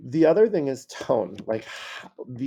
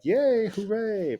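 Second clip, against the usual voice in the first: high, drawn-out mock hooray; demonstrated celebration; happy cheer; 10 semitones higher, slower than usual. Shown as a demonstration. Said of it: "all different emotions".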